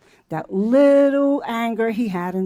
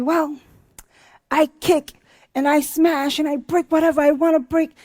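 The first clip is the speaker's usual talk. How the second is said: put-on defiant little boy; 6 semitones higher, 3 dB louder than usual. Boy voiced in retold story.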